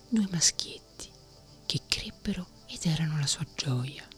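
ordinary delivery

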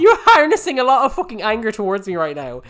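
high, loud squabbling voice; loud, high-pitched protest; exasperated accusation. Real, not acted. Accusing co-host in mock quarrel.

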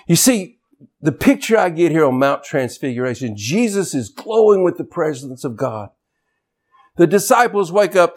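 usual speaking voice

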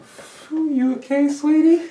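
high, soft, coaxing; mock tender parent; sweet parent voice. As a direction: gently check in on her. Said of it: "you be like".

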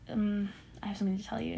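fast hushed whisper, breaking bad news. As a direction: whisper it quickly like a mother with hard news.

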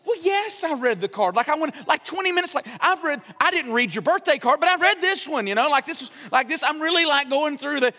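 high, rushed, defensive self-quote; defensive protest. Retold or imitated. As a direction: insist you really read it, voice climbing. His defensive protest, retold.

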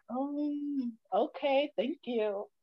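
small, soft acted voice; meek reply. Daughter acting out her answer to a lecture, meek and soft. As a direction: shrink back softly into polite compliance.

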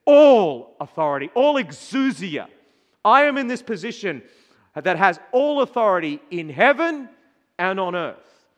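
proclaiming. Acted. Proclaiming Jesus' claim again.

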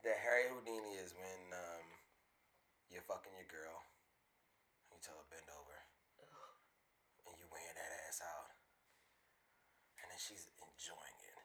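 low, soft, sleazy nasty voice. Pretend. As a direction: lean in low and soft, leering. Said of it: "This voice is".

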